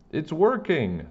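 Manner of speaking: high excited shout. A narrator's reading. A kid in the crowd shouts, high and excited. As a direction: cheer it out from the crowd. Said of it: "somebody shouted".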